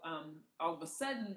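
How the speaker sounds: ordinary delivery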